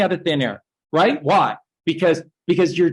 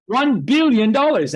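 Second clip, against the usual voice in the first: one billion dollars tossed back higher; mocking echo; 7 semitones higher than usual. Bankers echoing Obama's price, higher, mocking. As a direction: parrot the price back in mock awe. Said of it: "and they're going".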